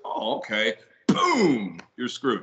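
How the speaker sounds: mock judge with a loud, high BOOM; pompous judge. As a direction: hand down a gloating mock verdict. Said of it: "you know how pompous, like, judges are".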